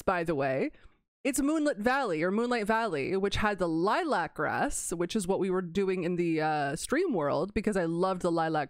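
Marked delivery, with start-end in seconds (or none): none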